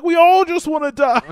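very high cartoon despair; mock despair in a squeaky cartoon voice; Meeseeks voice. Retelling the Meeseeks turning on each other, high mock-desperate voice. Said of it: "We're like".

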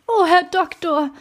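high-pitched, agitated outcry; impassioned exclamation; crying out. A narrator's reading. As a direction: cry out in protest to the doctor. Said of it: "rief Poole".

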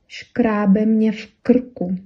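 teacher modelling a Czech phrase; slow example phrase. Shown as a demonstration. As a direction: spell out «Шкрабла мне кочка» word by word for learners. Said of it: "можете сказать".

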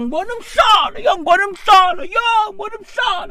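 high, fast, frantic yelling in Zulu; frantic spazzing man. Retold or imitated. Driver on a call to his wife, frantic high yell. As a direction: mimic a panicked man yelling on the phone.

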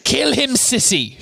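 high shrieked order to kill; Bellatrix shrieking. Acted. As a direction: shriek the command like a furious witch. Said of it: "shrieked Bellatrix".

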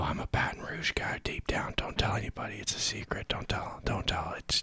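hushed conspiratorial whisper; secret whisper. Pretend. Podcaster whispering a secret to listeners, hushed.

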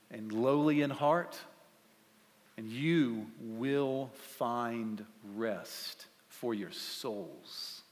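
slow, soft scripture reading; hushed slow reading of the verse. Real, not acted. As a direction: read it from a very deep place. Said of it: "Listen from a very deep place".

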